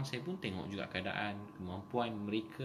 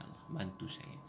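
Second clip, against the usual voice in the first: whispered plea; 7 dB quieter than usual. Acted. Voicing a pleading prayer.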